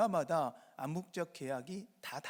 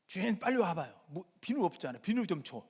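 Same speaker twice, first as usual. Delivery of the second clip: raised, louder, demanding call; blunt Jeolla customer; 3 semitones higher, 4 dB louder than usual. Acted. Jeolla customer calling the bathhouse owner about missing soap, bluntly demanding. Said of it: "전라도 사람들은 그래요".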